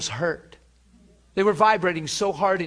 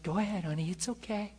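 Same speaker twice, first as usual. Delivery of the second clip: put-on soft, tender voice to a small child; reassuring a toddler, much softer; gentle encouragement; 11 dB quieter than usual. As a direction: coax a little kid softly. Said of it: "teaching a kid something and you pat 'em".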